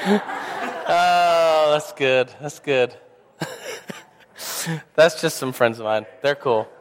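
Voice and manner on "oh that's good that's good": laughing. Real, not acted. Laughing at the prank.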